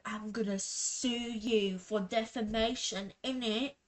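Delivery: mock British accent, quoting him. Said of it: "I did that accent".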